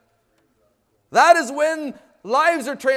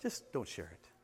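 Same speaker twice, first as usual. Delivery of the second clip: hushed, much softer tempting inner voice; inner voice whisper; 20 dB quieter than usual. Retold or imitated. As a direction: breathe it like a soft voice in your head. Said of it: "It's a beautiful voice".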